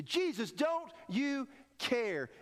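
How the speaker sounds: high, slow desperate cry; panicked plea. Acted. Terrified disciples plead with Jesus, high and slow. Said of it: "They say".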